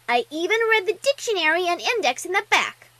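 put-on high, loud, eager child voice; squeaky little-girl pride; proud little filly. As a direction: boast like a small bookworm.

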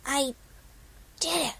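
hoarse whisper. Acted. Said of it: "she whispered in a hoarse voice".

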